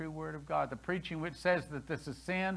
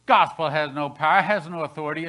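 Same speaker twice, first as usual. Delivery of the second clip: mock dismissal; 9 dB louder than usual. Mocking the rejecters' claim.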